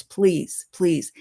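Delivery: ordinary delivery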